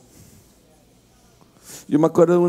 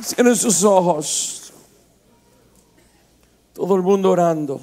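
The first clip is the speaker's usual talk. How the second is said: slow, lower, hushed prayer voice over music; altar call; 3 semitones lower, slower than usual. Acted. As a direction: draw them gently into prayer.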